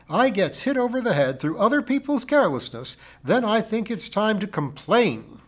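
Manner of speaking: high, loud, insistent complainer; aggrieved char lady. A narrator's reading. Missus Pringle fixes the narrator with a glittering eye, aggrieved.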